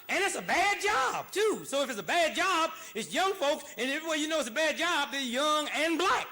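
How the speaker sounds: high, loud preacher shout; loud, high, emphatic shouting; shouting rant. Real, not acted. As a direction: hammer the point home at full volume. Building rant about bad jobs.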